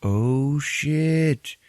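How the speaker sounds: drawn-out "oh shit," then it dawns on him; Doctor Strange. Retold or imitated. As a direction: play a hero's sudden realization. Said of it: "Doctor Strange is like".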